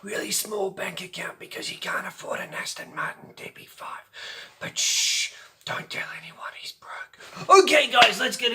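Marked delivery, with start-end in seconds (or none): 0.0-4.0 whispered aside; a conspiratorial whisper to the viewers. Pretend. Secret joke about Daniel Craig. 4.6-6.9 fast hushed whisper; a quick conspiratorial whisper. Pretend. Hushing the viewers.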